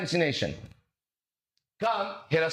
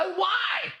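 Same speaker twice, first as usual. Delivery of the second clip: mock shout; 13 semitones higher than usual. Mocking a serious questioner.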